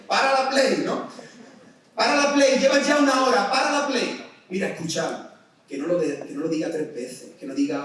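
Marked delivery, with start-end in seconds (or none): 0.1-4.2 higher, louder repeated yell; exasperated parent yelling. Retold or imitated. Preacher acts a parent shouting at a gaming son, exasperated yell. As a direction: keep shouting like a fed-up parent.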